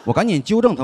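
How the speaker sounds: usual speaking voice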